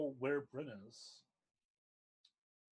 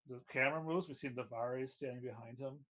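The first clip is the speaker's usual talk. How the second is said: ordinary talk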